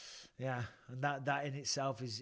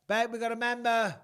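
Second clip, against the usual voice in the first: calling out; 8 semitones higher, 8 dB louder than usual. Real, not acted. Calling to his wife offscreen.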